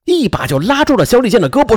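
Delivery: ordinary delivery, nothing marked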